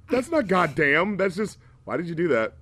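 usual speaking voice

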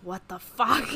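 loud, incredulous what the fuck; shocked reaction. Retold or imitated. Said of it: "everyone in the replies was like".

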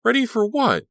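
high, loud startled question; alarmed. A narrator's reading. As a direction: demand it in alarm. Alarmed girl cuts in.

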